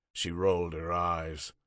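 ordinary delivery, nothing marked

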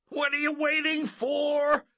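very high, loud frantic yell; shouting. Acted. Boy urging Coach to swat the wasp, shouting. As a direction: shout it in desperate impatience. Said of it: "Glenn shouted".

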